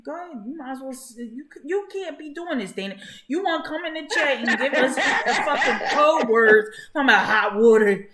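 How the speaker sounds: high, loud, laughing teasing at a friend; excited teasing. Real, not acted. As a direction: rib her for talking in code, voice way up. Teasing Dana about her code words.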